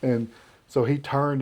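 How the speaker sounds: ordinary talk, nothing marked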